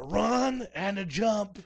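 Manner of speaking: loud, high, drawn-out burst; high yell on the dash; excited shout. Acted. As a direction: shout it slowly like a leap into bed. Acts out the dash to bed.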